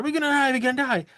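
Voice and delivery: high, rushed imitation of panic; mock freak-out. Retelling a panicking passenger in the film, mock freak-out. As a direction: play a passenger panicking, quick and high-pitched. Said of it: "freaking out".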